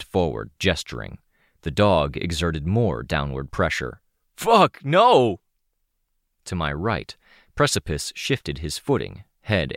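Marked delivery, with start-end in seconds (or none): 4.4-5.4 high, loud cry of pain; pained shout. Acted. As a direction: yell out as the weight presses. Dog presses down on him.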